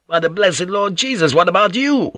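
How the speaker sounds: high, indignant challenge; angry retort held short of a shout; inward enraged outburst. Acted. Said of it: "he wanted to shout".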